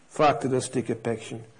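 usual speaking voice

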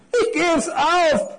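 high, drawn-out lament of giving up; sighing despair. A narrator's reading. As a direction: give up in slow, high exasperation. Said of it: "seufzte die Krawatte". (hohes, gedehntes, resigniertes Klagen)

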